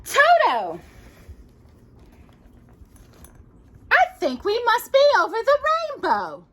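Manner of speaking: put-on high girlish Dorothy voice. Telling Jocelyn the story, high Dorothy voice. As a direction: play young Dorothy talking to her dog. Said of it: "she said".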